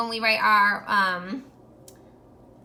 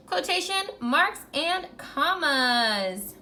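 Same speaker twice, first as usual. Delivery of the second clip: slow, drawn-out choral chant; slow class recitation; 5 semitones higher, much slower than usual. Shown as a demonstration. Teacher leading the class in a slow chant. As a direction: say it together slowly, word by word. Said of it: "Everyone say it with me".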